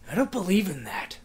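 unvoiced whisper to nobody. Real, not acted. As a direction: breathe it out as a secret. Whispered line in ghost game.

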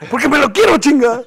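loud, high retold boy's outburst; indignant young son. Son tells dad he wants to fight, high loud outburst. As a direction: blurt it out like a fired-up kid. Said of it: "Y me dijo".

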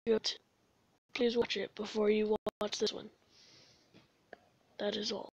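ordinary talk

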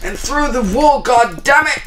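a loud, high curse; exasperated shout. Real, not acted. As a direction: blow up at the glitch. Glitching through a wall.

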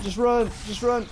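high urgent yell; urgent shout. Real, not acted. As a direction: yell at a teammate to flee. Teammate in danger.

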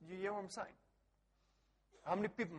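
ordinary talk

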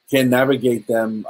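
usual speaking voice

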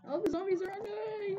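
high panicked wail; panicked cry. Real, not acted. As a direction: cry out, overrun and scared. Swarmed by zombies, cry heard.

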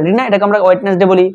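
normal talking voice

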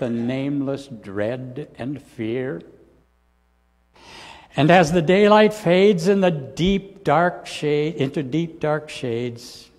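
hymn verse spoken with swelling then fading fervor; recited hymn lyrics. Acted. Preacher reciting the hymn to the congregation, fervent then hushed. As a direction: recite the hymn, rising to a loud peak, then sink to a hush.

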